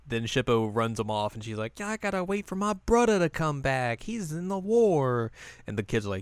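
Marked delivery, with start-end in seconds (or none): 1.8-5.3 put-on high-pitched little girl voice. Girl telling bullying children about her brother, high childlike voice.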